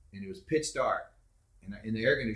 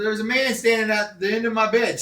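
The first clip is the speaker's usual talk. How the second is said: louder, higher report; telling Mom; 11 semitones higher, 8 dB louder than usual. Retold or imitated. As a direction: urgently tell Mom, raised. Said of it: "I said".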